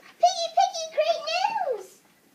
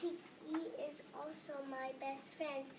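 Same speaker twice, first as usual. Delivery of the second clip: plain reading voice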